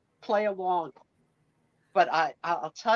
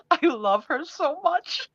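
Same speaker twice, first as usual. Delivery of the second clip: doting; 9 semitones higher, slower than usual. Real, not acted. Doting on her daughter.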